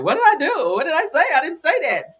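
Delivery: high, loud mock-innocent protest; Shamil playing innocent. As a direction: imitate someone loudly denying all blame. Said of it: "be sitting there looking like Drew, like".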